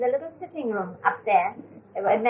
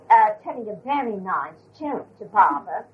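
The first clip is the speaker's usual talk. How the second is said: normal talking voice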